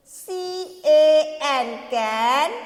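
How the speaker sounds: slow, drawn-out phonics chant; slow sounding out. Shown as a demonstration. Teacher drawing out sounds for kids, slowly. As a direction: stretch each sound before "can". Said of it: "ए एन को कैसे बोलेंगे?".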